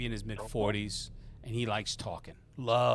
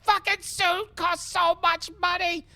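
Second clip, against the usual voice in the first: very high, loud complaint; hammed-up squawk of dismay; outraged wail; 11 dB louder than usual. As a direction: wail over a ruined suit. Mo wails over his soaked suit.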